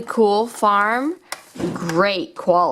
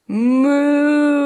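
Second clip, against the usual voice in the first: loud drawn-out moo; cow moo; 8 semitones higher, 4 dB louder than usual. Pretend. Found cow greeting the kids, a loud moo.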